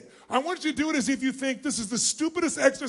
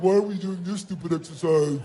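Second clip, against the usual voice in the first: bored adult; 5 semitones lower than usual. Retold or imitated. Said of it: "like an adult".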